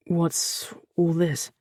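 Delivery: slow, rough words through a tight throat; emotion-clogged rough question; rough, choked. A narrator's reading. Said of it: "Arik asked roughly, emotion clogging his throat".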